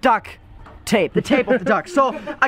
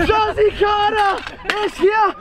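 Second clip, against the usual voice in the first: excited shout; 7 semitones higher, slower than usual. Real, not acted. Surprised friend arrives.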